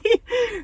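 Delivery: high, loud burst of real laughter. Real, not acted. Laughing as she tells of boyfriend begging for popcorn.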